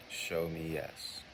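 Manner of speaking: gentle slowed ask. Shown as a demonstration. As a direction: ease the phrase out, softly. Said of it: "You're going to ask".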